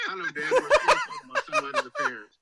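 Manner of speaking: high, loud rant breaking into laughter; laughing outrage. Real, not acted. Roasting a critic of people's looks, laughing loudly. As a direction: rant while cracking up.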